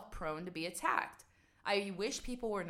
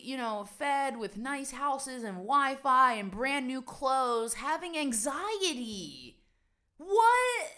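louder, slower build up to a high, loud What; mounting rant; 5 semitones higher, 8 dB louder, slower than usual. Real, not acted. Vlogger ranting about comfortable people having anxiety, loud exasperated. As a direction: build the list up to an outraged peak.

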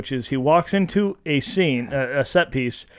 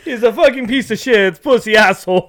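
loud, laughing mock-Italian insult; higher, hammed-up Italian tough guy impression; fake Italian accent; 5 semitones higher, 4 dB louder than usual. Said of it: "in a fake Italian accent".

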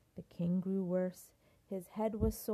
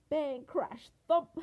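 loud sound effects; 5 semitones higher, 7 dB louder, slower than usual. A narrator's reading. Sound effects in read-aloud.

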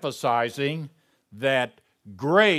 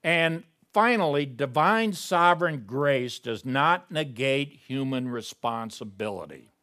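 ordinary delivery

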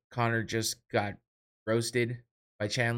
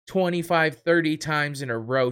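usual speaking voice

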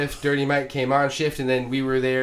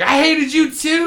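high, loud, slow playful yell; laughing shout; 9 dB louder than usual. Retold or imitated. Yelling back at Dirty Mike's confession, laughing and loud.

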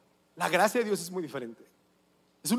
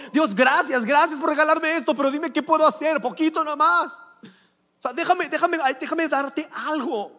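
higher, louder, pleading thanks to God; mock eager prayer; 7 semitones higher, 6 dB louder than usual. Believer eagerly trying to repay God's gift, higher and louder. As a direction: beg to pay God back, half-joking. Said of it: "Es como".

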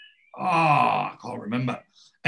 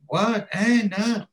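shocked disbelief; 10 semitones higher, 4 dB louder, slower than usual. Retold or imitated. Wayne's shocked reaction retold.

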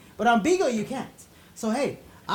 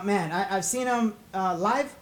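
normal talking voice

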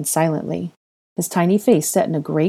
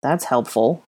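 low-key dry sarcasm; sarcastic; 3 semitones lower, slower than usual. Acted. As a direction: remark it drily. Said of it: "Ron remarked sarcastically".

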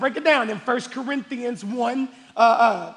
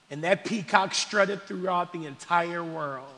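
normal talking voice

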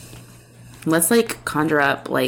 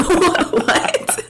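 mock shocked What, pitched way up; incredulous what; 12 semitones higher than usual. Friend hearing the witch claim, shrieking a high What. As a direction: act stunned at the nonsense. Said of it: "And it's like".

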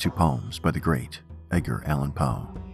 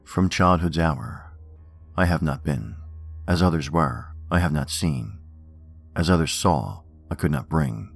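poem reading. Acted. Reads Poe poems aloud.

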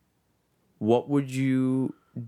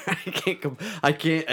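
laughing; 6 semitones higher than usual. Real, not acted. Cracking up at joke.

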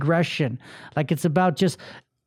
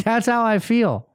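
normal talking voice

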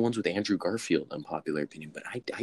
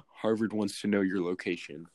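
usual speaking voice

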